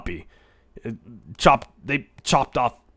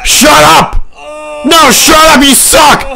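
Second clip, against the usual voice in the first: very high, very loud scream of protest; furious screaming; 15 dB louder, slower than usual. Real, not acted. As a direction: scream to make the track stop. Screaming at the moaning track.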